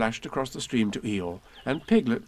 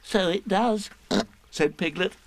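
much higher small-animal voice; squeaky Piglet; 9 semitones higher than usual. Acted. Piglet chiming in to Eeyore, squeaky and pleased. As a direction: pipe up like a tiny piglet.

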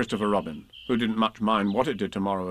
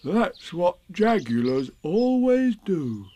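slow, higher, fascinated Pooh; much interested; 7 semitones higher, much slower than usual. A narrator's reading. Pooh explaining jagulars to Piglet, much interested. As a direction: savour it slowly with great interest. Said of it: "said Pooh, much interested".

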